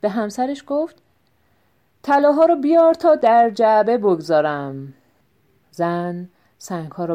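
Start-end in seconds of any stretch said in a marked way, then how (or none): 2.0-4.9 louder, staged for eavesdroppers; loud on purpose. Acted. Tinal loudly fools the thieves under the window. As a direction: call out so the thieves outside hear. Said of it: "سپس با صدای بلند، طوری که دزدا بشنوند".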